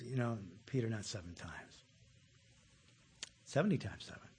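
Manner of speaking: soft, low and gentle answer; gentle Jesus. Acted. Jesus gently corrects Peter, soft and low. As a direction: let Jesus answer him quietly and kindly.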